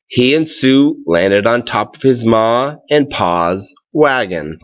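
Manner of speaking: ordinary delivery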